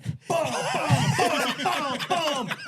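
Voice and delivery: loud, high mock hammer booms with laughter; imitating hammer blows at the stringer; sledgehammer booms. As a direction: bang it out like a sledgehammer.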